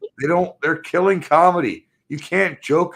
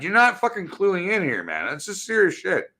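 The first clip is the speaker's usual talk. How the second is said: usual speaking voice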